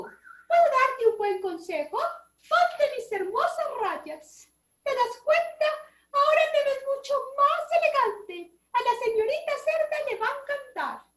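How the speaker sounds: a high, prissy zebra offering stripes. A narrator's reading. The zebra offers Don Chancho her stripes, very high. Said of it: "dijo la cebra".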